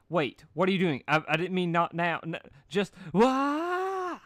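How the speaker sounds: startled pony shriek; panicked. Acted. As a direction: panic as the seesaw fires. Applejack catapulted, panics.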